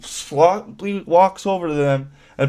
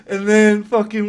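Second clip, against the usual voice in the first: laughing; 5 semitones higher than usual. Real, not acted. Laughing while retelling.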